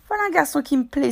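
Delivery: pitched high with admiration; admiring exclamation. A narrator's reading. Cook approving of young Dick's question, crying out admiringly. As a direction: exclaim your approval of the lad. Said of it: "s'écria le cuisinier d'un ton admiratif".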